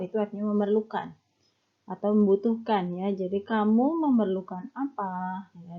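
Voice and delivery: usual speaking voice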